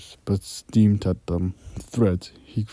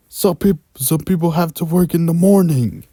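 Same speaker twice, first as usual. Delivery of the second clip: shouting; 10 semitones higher, 8 dB louder than usual. Acted. David shouting at noisy neighbours.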